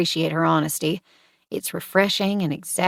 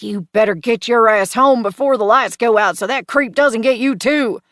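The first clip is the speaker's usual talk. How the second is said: loud, high mock-stern dad warning; hammed-up parental scolding; best daddy voice; 4 semitones higher, 8 dB louder than usual. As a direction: bark it like a fed-up Southern father. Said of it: "I say it in my best daddy voice".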